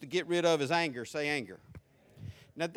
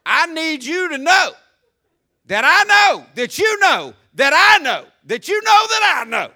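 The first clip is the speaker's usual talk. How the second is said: loud, high, rising shout; mother warning someone she sees through them; 7 semitones higher, 11 dB louder than usual. Retold or imitated. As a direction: build it up to a shout. Mom's warning, voiced.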